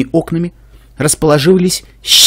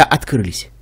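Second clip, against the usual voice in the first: usual speaking voice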